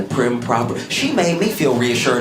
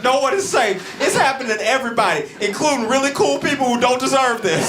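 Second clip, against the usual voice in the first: higher, hurried mock announcement; a hint of panic; 9 semitones higher than usual. Deputy mayor yells to the public, hint of panic. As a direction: rush it out in fake alarm.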